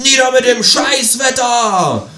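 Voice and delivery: slow, high, loud rally cry; protest shout. Acted. Narrator rallying against the weather, loud slow protest shout. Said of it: "rufe ich laut und recke die Faust".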